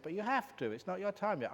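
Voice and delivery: a spirit laying down the rule; insisting spirit. Retold or imitated.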